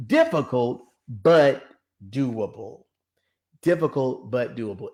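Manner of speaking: slow, spaced-out "Difficult ... but ... doable"; measured, word-by-word delivery of "Difficult but doable"; emphatic slogan. Real, not acted. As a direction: pause after "but", let "doable" drop. Preacher drilling the slogan.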